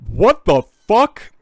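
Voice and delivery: very high, loud, slow stunned shout; shocked outburst. Real, not acted. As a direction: blurt it out in total disbelief. Reacting to the ten Bitcoin offer.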